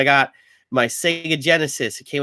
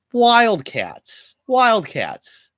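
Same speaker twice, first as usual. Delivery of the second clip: announcer voice; 10 semitones higher, much slower than usual. Pretend. Announcing team names.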